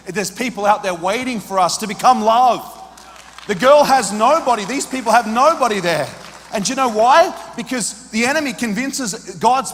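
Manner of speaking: loud, high preaching cry; rising to a loud, impassioned shout. Real, not acted. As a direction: preach it out at full force.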